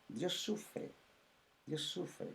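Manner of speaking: a sorrowful hushed repetition; tender hush. Acted. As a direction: let it fall to a near whisper. Pastor describes God's suffering.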